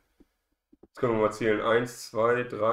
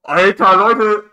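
excited shout; 6 semitones higher, 5 dB louder, slower than usual. Real, not acted. Overtake in the final lap.

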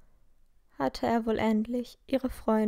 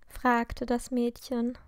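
usual speaking voice

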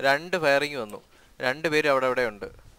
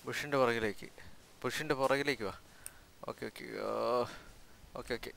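much softer, hushed under breath; whispering while hiding; 3 semitones lower, 14 dB quieter than usual. Real, not acted. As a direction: keep it low so the enemy can't hear. Hiding behind a bush from enemies. (ഒളിച്ചിരുന്ന് പതുക്കെ മന്ത്രിക്കുന്നു)

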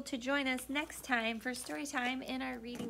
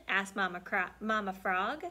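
ordinary delivery

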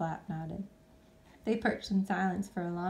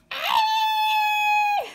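very high loud scream; shriek; 11 dB louder than usual. Acted. Flap falling in the dark, shrieking. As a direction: shriek in fright. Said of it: "shrieked Flap".

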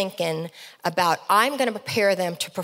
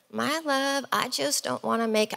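higher-pitched, lightly imitated Southern husband; reluctant husband with a hint of Southern accent; 5 semitones higher, 3 dB quieter than usual. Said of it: "I have to do the Southern accent".

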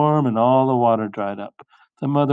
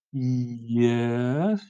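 drawn-out "yes"; coy admission; 4 dB quieter than usual. Acted. Olivia owning up to her parents, coy and drawn-out. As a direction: stretch the word before owning up. Olivia reluctantly admits three-word wish.

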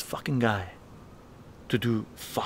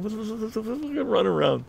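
high confused voice; 10 semitones higher than usual. Retold or imitated. Friend high on salvia.

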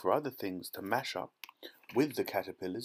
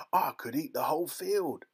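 put-on higher, livelier old grandpa; grinning delight; 5 semitones higher, 4 dB louder than usual. A narrator's reading. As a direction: let delight lift the voice through a grin. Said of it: "grinning with delight".

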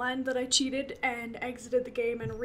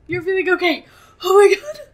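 crying with relief; 7 semitones higher, 17 dB louder than usual. Real, not acted. Relief Riley survived.

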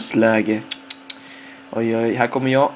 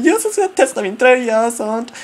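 excited yes; 8 semitones higher than usual. Retold or imitated. Cheering while trying on the shirt.

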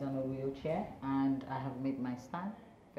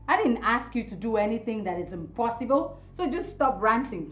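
loud, higher-pitched annoyed rebuttal; irritated retort; 5 semitones higher, 7 dB louder than usual. Acted. Woman tells partner to stop ranting, irritated. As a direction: cut the ranting off forcefully. Quarrel, snapping back at partner.

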